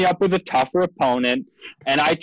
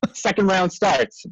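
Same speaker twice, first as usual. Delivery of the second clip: usual speaking voice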